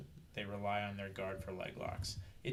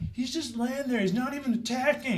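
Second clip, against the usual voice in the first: much higher, yelled complaint from the sidelines; mock the other corner's heckling; imitating opposing team; 9 dB louder than usual. Opposing team heckling from the sidelines, high mocking yell. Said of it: "his team go".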